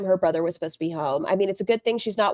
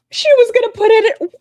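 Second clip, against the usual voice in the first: shocked outrage; 13 semitones higher, 9 dB louder than usual. Real, not acted. Outraged at streamer's trash.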